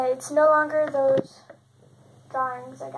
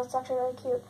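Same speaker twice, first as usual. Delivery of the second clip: ordinary delivery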